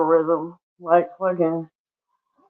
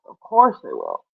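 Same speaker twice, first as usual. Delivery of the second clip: much lower, softer "of course"; 5 dB quieter than usual. Pretend. Teasing viewers about fashion, sudden deep voice. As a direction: drop the voice deep for a mock sneer.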